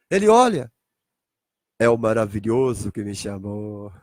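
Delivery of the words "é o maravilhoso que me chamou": awed recognition. Acted. Abraão realising who called him, low awed recognition.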